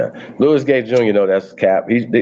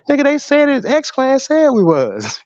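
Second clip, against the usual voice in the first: much higher, slow, hammed-up convinced fan; mock true believer; slower than usual. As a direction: play the duped fan with a squeaky sure voice. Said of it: "They be like".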